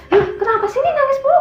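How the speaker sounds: put-on a high, exasperated cry at crying kids; exasperated outburst. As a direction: snap at the babies in frustration. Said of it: "Jadi pas gue ngomong".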